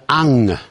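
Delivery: "on" said as "ong"; drawn-out "ong" for "on"; common Chinese mispronunciation. Shown as a demonstration. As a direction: close "on" with a back ng sound. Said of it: "中国人的发音呢".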